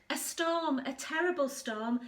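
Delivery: usual speaking voice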